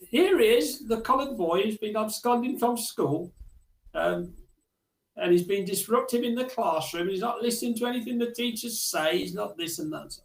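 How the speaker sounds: accusing teacher. Retold or imitated. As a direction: rattle off complaints like a cross teacher. Teacher accusing boy to headmaster.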